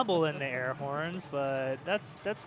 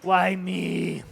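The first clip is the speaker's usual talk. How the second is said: exasperated cry; 2 semitones higher, 8 dB louder than usual. Real, not acted. Exasperated at stream lag.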